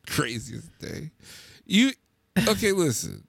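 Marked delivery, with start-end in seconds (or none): 0.1-1.1 slow, breathless whisper to himself; slow, voiceless, fed-up whisper; exasperated whisper. Real, not acted. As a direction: mutter it under your breath, worn out. Exasperated aside under breath.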